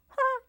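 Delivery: short high croaked "ha"; a frog's tiny laugh; quick high froggy laugh; little croaky laugh. A narrator's reading. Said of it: "croaked a little laugh".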